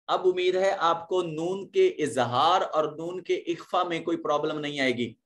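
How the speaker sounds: ordinary talk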